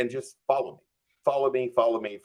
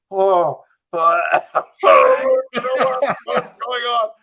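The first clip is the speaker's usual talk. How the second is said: high, loud flustered stammer with a laugh; panicked stammer; 8 semitones higher, 7 dB louder, slower than usual. Retold or imitated. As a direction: stumble over the words in panic, pitch up. Caught by sheriff with weed.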